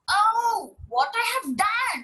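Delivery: high dismayed cry; hammed-up mother's horror; mock shocked shout. A narrator's reading. Mother crying out over the bird, high and dismayed. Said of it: "Payal mother shouted".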